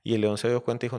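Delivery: plain reading voice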